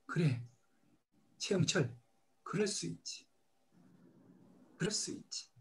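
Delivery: a quiet, tender reassurance to himself; gentle self-forgiveness. Retold or imitated. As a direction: comfort yourself like a kind friend.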